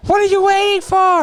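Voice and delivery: high, drawn-out taunting call; mock taunt. Student calling up to the teacher on the roof, mock taunt. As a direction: call it up to him, slowly and mocking.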